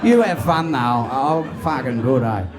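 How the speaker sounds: put-on broad Australian accent; Aussie bloke. Aussie bloke declining traditional heaven, broad Australian accent. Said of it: "I just think an Aussie bloke be like".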